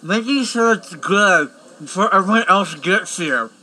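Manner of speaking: talking while chewing; words round a bite of food; mouth full. Acted. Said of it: "testing the food out".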